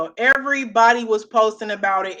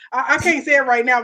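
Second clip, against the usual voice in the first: normal talking voice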